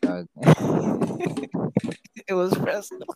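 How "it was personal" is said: high, laughing echo through giggles. Real, not acted. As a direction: laugh it out while repeating. Laughing echo of friend's line.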